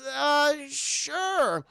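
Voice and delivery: high, drawn-out reluctant acted yes; hesitant agreement. Mabel accepting the date, high and hesitant. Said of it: "And she goes".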